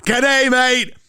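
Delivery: high, loud, slow mock Aussie; hammed-up Australian accent. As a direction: ham up the outback bloke, slowly. Hamming an Australian stereotype.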